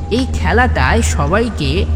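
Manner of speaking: ordinary delivery, nothing marked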